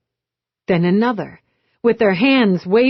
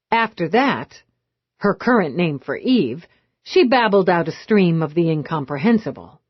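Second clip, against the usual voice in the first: usual speaking voice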